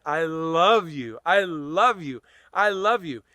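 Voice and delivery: heartfelt, swelling declaration of love to yourself; felt self-love. Shown as a demonstration. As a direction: really feel the love as you say it. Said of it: "and feel the love".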